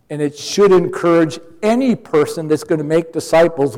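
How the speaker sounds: ordinary talk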